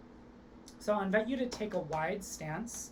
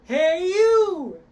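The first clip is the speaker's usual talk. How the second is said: high loud call, hey you; 11 semitones higher, 14 dB louder than usual. Shown as a demonstration. Coach demonstrates a loud calling hey you. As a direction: call out across a room. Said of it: "Try that".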